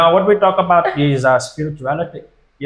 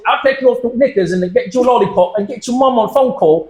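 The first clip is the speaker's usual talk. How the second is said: mock British accent; 7 semitones higher than usual. Continues British mockery.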